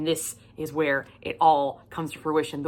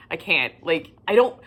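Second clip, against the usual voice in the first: ordinary delivery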